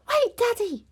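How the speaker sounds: put-on high, loud child's call; urgent cry. A narrator's reading. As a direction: cry out to stop father. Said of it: "cried Peppa".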